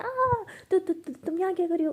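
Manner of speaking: high, loud yelp into a stammer; shriek, then stumble into the question; startled shriek. Acted. चौंकी भावना की चीख, घबराहट. (चौंक कर चीखते, हकलाते हुए)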